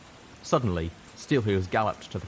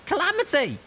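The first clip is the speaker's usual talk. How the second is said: sharp shout, much higher and louder; 6 dB louder than usual. A narrator's reading. Littlepip shouts to Calamity at the controls, much higher and louder. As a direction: yell his name across the cabin. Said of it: "I shouted".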